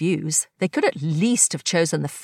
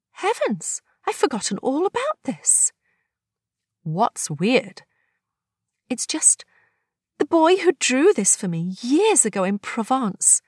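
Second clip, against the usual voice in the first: higher voice, wistful surprise; Agnes, nostalgic; 6 semitones higher than usual. Acted. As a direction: recall a long-lost memory.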